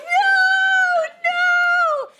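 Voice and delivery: panicked cry. Retold or imitated. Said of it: "I'm like".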